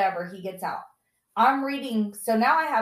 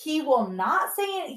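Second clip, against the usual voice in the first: ordinary talk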